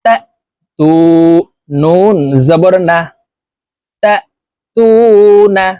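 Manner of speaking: slow, measured syllable-by-syllable recitation; deliberate teacher's model recitation; Quran spelling drill. Shown as a demonstration. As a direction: spell out each letter evenly, then chant the joined word.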